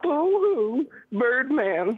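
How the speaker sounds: high mock boo-hoo; fake-sob in a whine; mock crying. Caller taunts the host as a crybaby. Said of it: "here's an example of what you sound like".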